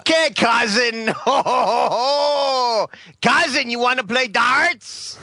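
high, hammed-up cousin voice; excitable cousin character. Inviting his cousin to play darts, excitable character voice. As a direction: call out merrily in a silly put-on voice. Sudden character voice, host asks what he's doing.

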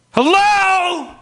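loud, high, annoyed; irritated hello. Retold or imitated. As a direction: bark it up high, put out. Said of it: "It's not pleasant".

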